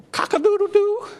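high but restrained cock-a-doodle-doo; mild rooster crow. Shown as a demonstration. Preacher showing how the rooster didn't crow, high and restrained. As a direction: crow politely, high and small, lifting at the end. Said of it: "it just didn't go".

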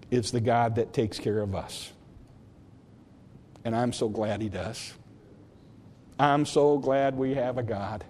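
low, soft altar-call voice; preacher's soft closing invitation to the congregation; quiet invitation. Acted. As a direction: draw them in gently.

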